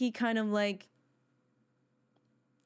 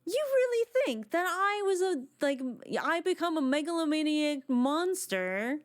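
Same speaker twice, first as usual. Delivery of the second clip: higher, lightly acted wounded villain; imitating the villain's line; 6 semitones higher than usual. Fan replaying the voice actor's line, a lightly mimicked indignant villain. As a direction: echo the actor's hurt disbelief, half-hearted. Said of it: "The delivery on that line by the voice actor".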